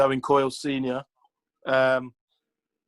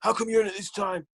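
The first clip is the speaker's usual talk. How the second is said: higher, pushy mock manager demanding answers; in-your-face Lenny; 5 semitones higher than usual. Manager quizzing an early player in the hall, mock aggressive. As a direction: play a nosy, confrontational boss, pitched up. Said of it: "He's in your face".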